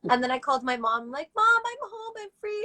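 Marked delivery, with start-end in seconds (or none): 1.4-2.6 very high, gleeful announcement; retold excitement. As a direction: re-enact breaking great news to mom. Excited call to mom, acted out.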